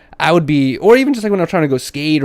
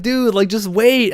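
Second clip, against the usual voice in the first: drawn out, slow and pitched up; slow insistent wait; 6 semitones higher, much slower than usual. Retold or imitated. Telling Riley in the car, slow and insistent. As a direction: stretch out the plea to wait. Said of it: "I'm like".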